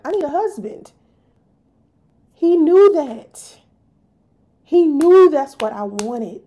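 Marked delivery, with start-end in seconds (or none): 2.3-6.4 emphatic. Real, not acted. Emphatic accusation.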